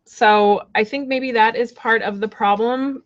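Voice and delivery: usual speaking voice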